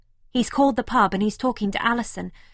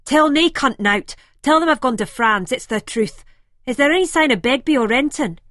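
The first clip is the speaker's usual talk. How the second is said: louder, higher, clipped orders into the phone; snapping; 5 semitones higher, 7 dB louder than usual. A narrator's reading. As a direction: snap urgent orders down the line. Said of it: "he snaps back into the phone".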